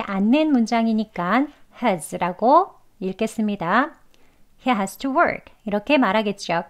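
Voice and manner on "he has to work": "has" unstressed and light, final k barely heard; weak has. Shown as a demonstration. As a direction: say "has" weakly, not stressed. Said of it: "has를 강조하지 않고".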